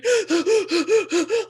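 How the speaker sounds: high loud tearful gasp; a sharp gasp on the edge of tears. Retold or imitated. Filmed herself in tears by the road, gasping. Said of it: "me in tears going".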